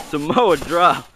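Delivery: ordinary delivery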